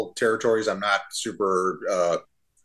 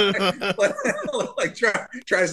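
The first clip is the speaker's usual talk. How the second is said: higher, talking through giggles; laughing; 10 semitones higher, much slower than usual. Real, not acted. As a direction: barely get the words out past the laughter. Describing clip while laughing.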